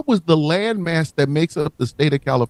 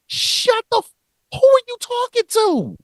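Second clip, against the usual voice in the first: much higher, thin comic voice; squeaky Mississippi voice. Pretend. Mississippi talking back to New York, in a squeaky high voice. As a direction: play the state as a tiny, indignant squeaker.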